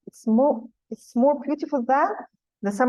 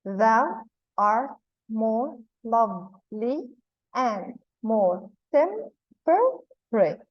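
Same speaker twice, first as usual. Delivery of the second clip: "Thou art more lovely and more temperate" broken into slow, separate beats; slow demonstration of the meter in "Thou art more lovely and more temperate", pausing between syllables; syllable-by-syllable scansion; much slower than usual. Shown as a demonstration. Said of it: "one stressed and one unstressed syllable".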